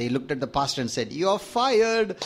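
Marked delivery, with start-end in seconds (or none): 1.1-2.1 sudden high outcry of offended church folk; angry church members. Acted. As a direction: fire him with a high indignant yelp. Church members fire the pastor.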